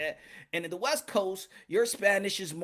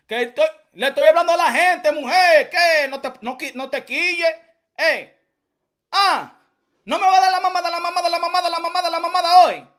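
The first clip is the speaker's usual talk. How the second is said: louder and higher, defending himself; heated shout at his partner; loud heated arguing; 5 semitones higher, 9 dB louder than usual. Real, not acted. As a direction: snap back at her, loud and exasperated.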